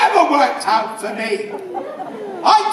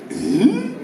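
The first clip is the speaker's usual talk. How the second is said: a royal grunt pushed higher; raised grunt; 7 semitones higher than usual. Retold or imitated. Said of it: "he'll raise his voice a bit".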